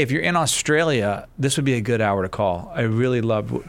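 ordinary talk

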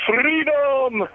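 high, drawn-out battle cry; hammed-up rallying cry, stretched long; William Wallace. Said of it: "just my William Wallace tribute".

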